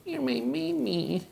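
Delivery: tiny, very high soft squeak; talking-to-animals voice. Acted. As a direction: squeeze it into a teensy pet-cooing voice. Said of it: "talk to animals like that".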